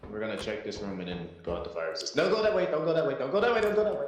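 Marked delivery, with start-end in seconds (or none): 2.1-4.0 frantic warning shout; urgent shouting. Real, not acted. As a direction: yell it in a panic. Panicked warning to teammate.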